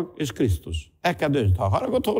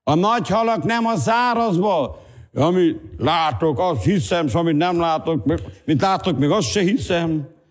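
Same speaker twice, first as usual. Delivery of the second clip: fervent preacher with sustained raised pitch; impassioned preaching; 6 semitones higher than usual. Real, not acted. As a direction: proclaim it to the congregation. Preaches at full force.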